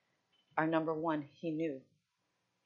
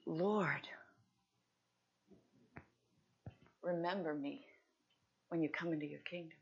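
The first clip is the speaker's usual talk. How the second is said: quiet plea; 5 dB quieter than usual. Acted. Thief's quiet plea on the cross.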